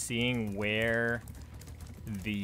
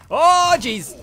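loud, high outburst of dismay; alarmed cry; 16 dB louder than usual. Real, not acted. Player reacts to game mishap, loud high cry. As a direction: shout it out, pitched way up.

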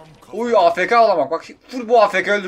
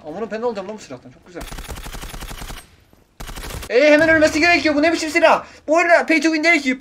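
normal talking voice

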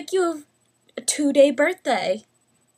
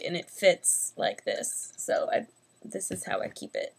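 quiet mumble; 5 semitones lower, 8 dB quieter than usual. Real, not acted. Quiet aside while showing the desk.

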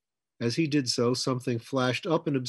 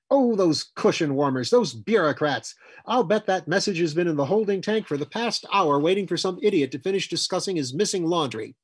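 loud, high, indignant rant; exploding in anger; 7 semitones higher, 6 dB louder than usual. A narrator's reading. Woman raging at bureaucrats over a late warning, exploding. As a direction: rail at them loudly, pitch up. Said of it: "Hazel exploded".

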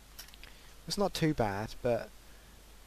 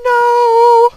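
a high, loud despairing no; anguished shout; 18 dB louder than usual. Real, not acted. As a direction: cry out as you die. Dies in game.

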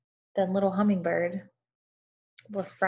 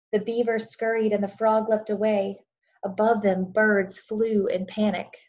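storytelling narration. A narrator's reading. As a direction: tell it like a bedtime story to a class. Reads story aloud to children.

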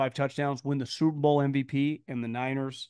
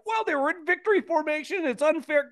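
whiny complaining voice, pitched up; mock complaint; 12 semitones higher than usual. Host mocking complaining fans, whiny and pitched up. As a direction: mimic fans griping. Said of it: "I've gotten people like".

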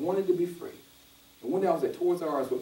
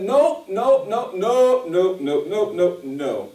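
his spirit shouting no at the offer, loud; loud inner no; 2 semitones higher, 6 dB louder, much slower than usual. Retold or imitated. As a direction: hammer each no down. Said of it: "But in my spirit, I said".

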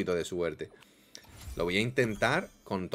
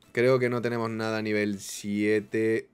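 slow delivery with SIETE stretched out; slow, drawn out; slower than usual. Real, not acted. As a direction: draw it out, lingering on "siete". La repite alargando las palabras. (lento, alargando "siete")

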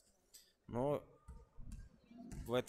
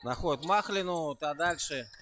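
louder, higher commentary riding the attack; live play-by-play; 4 semitones higher, 5 dB louder than usual. Real, not acted. Commentator follows a drive across the court, excited play-by-play. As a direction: call the fast break with rising energy.

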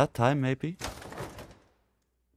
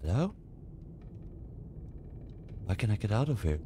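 nervous murmur; 3 semitones lower, 13 dB quieter, faster than usual. Real, not acted. Stuck in dark level.

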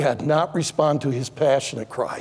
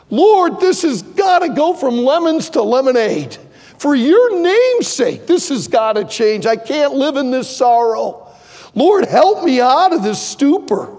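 desperate cry to God; 5 semitones higher than usual. Retold or imitated. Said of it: "dependent entirely on Christ's prayer".

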